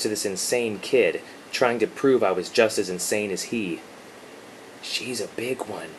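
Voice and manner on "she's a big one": soft hushed whisper. A narrator's reading. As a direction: whisper it close. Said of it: "he whispered".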